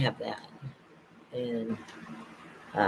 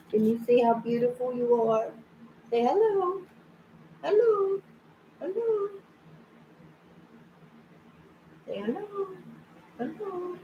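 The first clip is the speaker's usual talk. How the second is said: much higher sing-song coaxing; 12 semitones higher, 4 dB quieter than usual. Real, not acted. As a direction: coax the cat to say hello, high and sweet. Coaxing cat to greet viewers.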